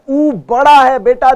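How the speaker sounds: usual speaking voice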